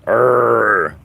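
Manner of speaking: loud put-on growl; mock monster growl. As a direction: growl back at the demons. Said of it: "I'm like".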